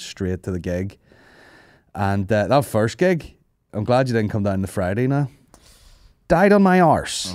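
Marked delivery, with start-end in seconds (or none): 6.3-7.3 high, loud, drawn-out declaration; slow, raised-pitch announcement of a disaster; comic confession. Real, not acted. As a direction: belt out the failure for laughs. Telling how badly the gig went.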